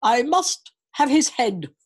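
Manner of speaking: slow, loud, stern royal voice; stern king. A narrator's reading. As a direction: lay down the law as king. King speaking in story.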